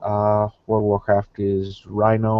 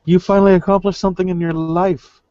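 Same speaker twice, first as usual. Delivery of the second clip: teasing; 9 semitones higher, 5 dB louder, faster than usual. Acted. Teasing friend about title.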